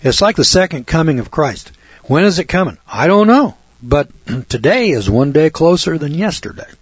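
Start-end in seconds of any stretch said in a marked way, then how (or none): 2.8-3.6 exclaimed. Real, not acted. Emphatic own answer.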